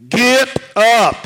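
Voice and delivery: a huge bellowed command; loud shout. Shown as a demonstration. Preacher startling his congregation on purpose, bellowed. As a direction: blast it at the room full force. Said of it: "but I'm gonna do it anyway".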